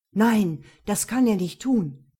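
loud protesting cry; loud outburst. Acted. Mohammed crying out so guests turn round, loud. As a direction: blurt it out loud in shock. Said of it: "hatte Mohammed so laut gesprochen".

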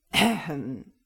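loud, gruff devil's "ahem"; growled throat-clearing. A narrator's reading. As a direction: cut in with a growling cough. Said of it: "knurrte der Orangeteufel". (knurrendes Räuspern)

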